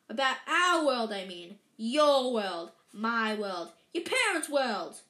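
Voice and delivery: loud, emphatic spelling-out; exasperated. A narrator's reading. Giant explaining to the clueless boy, exasperated. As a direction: punch each kind of world.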